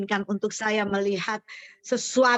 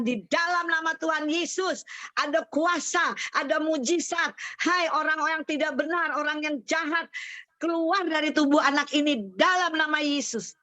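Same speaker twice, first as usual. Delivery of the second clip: insistent, raised-pitch prayer declaration; retold exorcism command; 6 semitones higher than usual. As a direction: re-enact ordering a spirit out. Retold prayer casting out spirit.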